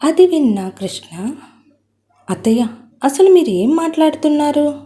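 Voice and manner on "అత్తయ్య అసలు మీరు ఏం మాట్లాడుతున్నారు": can't believe what he's hearing; astonished. Acted. Son-in-law questioning his mother-in-law, astonished. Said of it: "అని ఆశ్చర్యంతో అన్నాడు".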